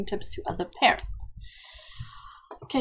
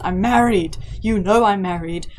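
exasperated protest; 2 semitones lower, 7 dB louder than usual. Pretend. Refusing a date request.

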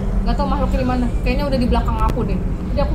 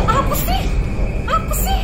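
startled; 12 semitones higher than usual. Real, not acted. Sees strange creature.

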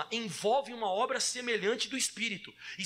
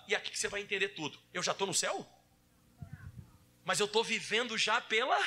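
normal talking voice

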